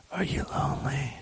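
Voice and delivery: hushed, whispered question. Pretend. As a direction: whisper it very softly into the mic. Whispers question, repeats it aloud.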